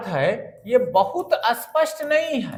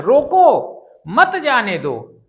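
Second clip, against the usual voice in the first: loud, slow "रोको", break, then "मत जाने दो"; pause after रोको; 5 dB louder, slower than usual. Shown as a demonstration. Teacher repeats stop-them reading, pause after "रोको". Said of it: "कुछ आपको ठहरना है".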